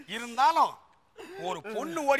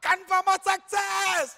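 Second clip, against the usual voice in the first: higher, hammed-up victory cry; triumphant; 4 semitones higher, 3 dB louder than usual. Groom celebrating a confirmed bride, triumphant.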